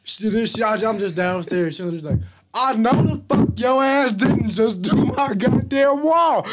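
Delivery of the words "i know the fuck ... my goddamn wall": high, slow scolding mom; angry mom. Retold or imitated. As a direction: scold the kid slowly. Mom yelling, retold.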